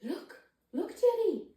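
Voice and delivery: put-on high, bright sing-song to a baby; excited baby talk. Shown as a demonstration. Parent showing a baby a book, in high excited baby talk. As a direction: coax the baby to look, pitched high. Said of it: "and maybe saying".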